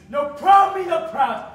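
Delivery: angry. Acted. Father rages.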